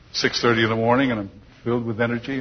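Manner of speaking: ordinary talk, nothing marked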